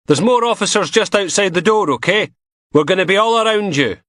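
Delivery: lightly voiced officer giving orders; mildly voiced copper talking him through; policeman. Policeman reassuring Knox.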